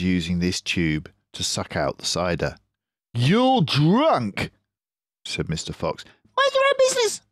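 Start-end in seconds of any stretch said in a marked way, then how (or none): 3.1-4.5 higher, louder fox voice; scornful Mister Fox. Acted. Mister Fox tells Rat he is drunk. 6.0-7.2 very high, loud squealing rat; shrieking Rat. Acted. Rat shrieks at fox and badger for poaching. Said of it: "shrieked Rat".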